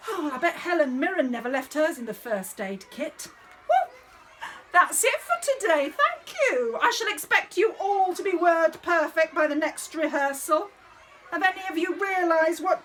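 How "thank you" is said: ordinary talk, nothing marked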